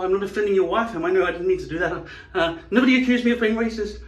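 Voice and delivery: high, loud, flustered over-apologising; panicked denial. Acted. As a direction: babble frantic excuses in a high voice. Acting someone terrified of seeming racist.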